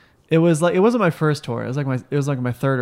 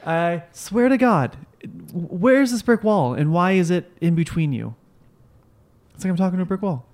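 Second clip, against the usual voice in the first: exasperated; 4 semitones higher than usual. Real, not acted. Fed up with being ignored.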